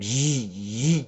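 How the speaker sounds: drawn-out electrical buzzing; imitated power surge. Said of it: "It kinda surged".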